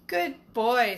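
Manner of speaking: usual speaking voice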